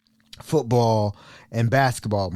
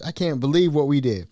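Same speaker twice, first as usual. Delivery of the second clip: ordinary delivery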